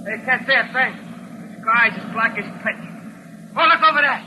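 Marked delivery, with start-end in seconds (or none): 3.6-4.2 high, loud startled call; startled cry at something in the sky; sudden alarm. Acted. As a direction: point it out in alarm. Spots strange light in sky.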